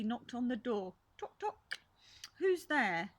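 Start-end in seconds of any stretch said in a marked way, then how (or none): 1.2-1.8 knock sound. Acted. 2.4-3.1 higher, louder call of a small voice; a hint of a little voice calling out. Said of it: "said a little voice".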